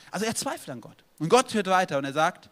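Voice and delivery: ordinary talk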